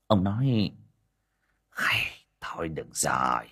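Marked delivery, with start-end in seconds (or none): 1.7-3.4 unvoiced, reluctant whisper; whispered agreement. A narrator's reading. Đồng reluctantly agrees to help, whispering. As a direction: give in under the breath.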